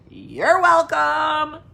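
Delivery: hammed-up gracious. Teasing viewers after showing feet.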